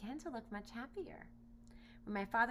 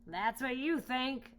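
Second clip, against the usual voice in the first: slow, louder lion threat; sly lion; 5 semitones higher, 7 dB louder, slower than usual. Acted. Lion telling the boy he won't get home, slow and loud. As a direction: savour the threat slowly. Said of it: "said the lion".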